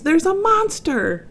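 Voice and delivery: high, slow call of alarm; monkey's alarm. A narrator's reading. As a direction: stretch out the warning. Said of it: "said the monkey".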